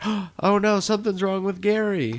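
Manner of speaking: high, alarmed mock worry; gasped-in panic, played for a retelling; alarmed SpongeBob retelling. Retelling SpongeBob alarmed about Gary.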